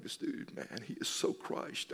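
whispered, under his breath; hushed aside. Retold or imitated. Pastor recalling his thought at the hospital, whispered. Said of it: "I'm like".

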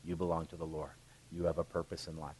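tender mom. Retold or imitated. Mother telling young Samuel his purpose, tender. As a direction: hush it to a small child. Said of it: "his mom was saying".

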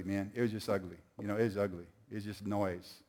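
normal talking voice

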